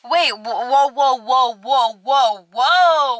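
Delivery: loud, rising run of whoas; alarmed whoa. Retold or imitated. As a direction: stop her mid-sentence in shock. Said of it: "And I was like".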